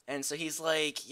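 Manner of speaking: normal talking voice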